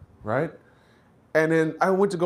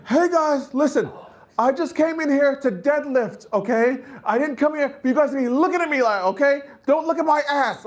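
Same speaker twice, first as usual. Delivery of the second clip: mock gym-goer in a thong; 10 semitones higher than usual. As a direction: play offended at being stared at.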